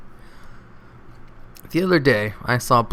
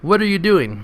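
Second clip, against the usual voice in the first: higher, louder furious cry; yelling; 8 semitones higher, 5 dB louder than usual. Retold or imitated. Father yelling at the doctor who dropped his baby. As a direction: shout the question at the doctor. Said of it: "starts yelling at the doctor".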